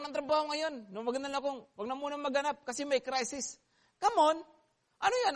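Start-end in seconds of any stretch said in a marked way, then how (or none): 4.0-5.3 incredulous rebuke. Real, not acted. Preacher scolds mindset.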